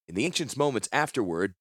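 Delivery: ordinary delivery, nothing marked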